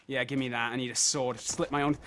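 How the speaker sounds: normal talking voice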